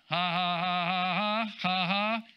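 steady mock belly "ha ha ha"; put-on run of spoken ha-has; imitated laughing. Retelling how a minister laughed at the devil, imitated laughing. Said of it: "He just started laughing".

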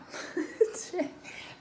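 a quick breathy laugh after the quote; short laugh. Real, not acted. Reader laughing at her own line to viewers, short laugh. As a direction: laugh it off lightly.

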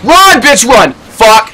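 loud, high frantic urging; panicked shouting. Real, not acted. Gamer urging the character to flee, panicked shouting. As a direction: shout as if chased for real. Fleeing the monster.

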